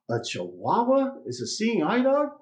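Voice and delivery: high, disbelieving question in a put-on owner voice; incredulous owner. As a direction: raise the pitch in disbelief. Restaurant owner incredulous at blind man.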